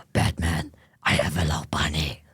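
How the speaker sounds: very low, rasping superhero voice; Batman. Acted. Streamer playing Joker to Batman, in deep growly voice. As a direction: drop it deep into a rough growl. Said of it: "That's Batman's voice, not Joker's".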